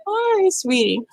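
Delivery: high-pitched, sweet hello; affectionate greeting. Real, not acted. Greets someone affectionately mid-stream.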